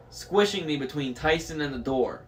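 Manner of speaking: ordinary delivery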